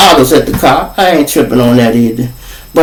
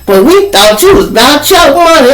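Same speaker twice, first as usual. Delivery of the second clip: accusing dealer; 9 semitones higher than usual. Retold or imitated. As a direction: drag it out high, calling him a liar. Dealer accuses him, quarrel.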